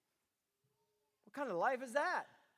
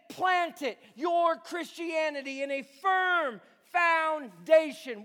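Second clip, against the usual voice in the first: loud, high, slow preaching; impassioned preaching; 5 semitones higher, 8 dB louder than usual. Retold or imitated. Paul urging the folks to stand firm, loud impassioned preaching. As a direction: plead with the folks, full force. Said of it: "Paul was saying here this".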